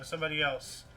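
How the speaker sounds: normal talking voice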